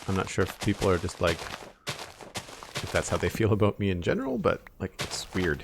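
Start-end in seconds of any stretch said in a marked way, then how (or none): none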